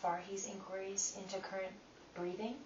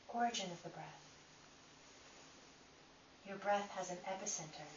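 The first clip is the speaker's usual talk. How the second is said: calm, unhurried guiding voice with long pauses; guided meditation; 6 dB quieter than usual. Acted. Teacher guiding listeners through breath inquiry, calm meditation voice. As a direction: lead them inward one gentle question at a time.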